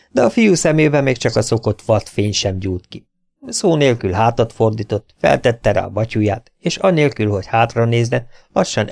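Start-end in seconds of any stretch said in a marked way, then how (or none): none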